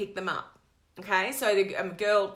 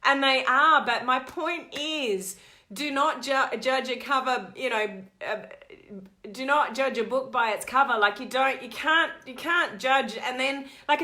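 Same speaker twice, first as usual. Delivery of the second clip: ordinary delivery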